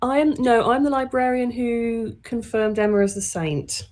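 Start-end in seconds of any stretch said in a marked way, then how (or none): none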